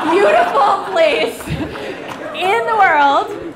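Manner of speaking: drawn-out, rising, with a laugh; gushing. Real, not acted. Speaker gushing to the crowd about Tasmania, drawn out and laughing. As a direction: stretch it out in delight, laughing.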